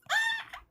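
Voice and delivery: high excited shriek; fangirl squeal. Real, not acted. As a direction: squeal like a delighted fan. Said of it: "I'm fangirling".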